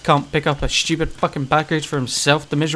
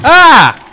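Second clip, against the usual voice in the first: startled scream; 12 semitones higher, 15 dB louder than usual. Real, not acted. Jump scare.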